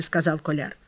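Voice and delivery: ordinary delivery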